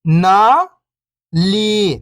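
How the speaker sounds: first syllable lifting, pause, second falling. Shown as a demonstration. Teacher breaking a word down for beginning readers, syllable by syllable. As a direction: sound out each syllable alone, long and separated. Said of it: "एक अक्षर का उच्चारण करके".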